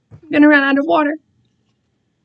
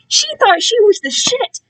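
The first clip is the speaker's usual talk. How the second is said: mocking; 10 semitones higher than usual. Mocking the stuck-up choir girl.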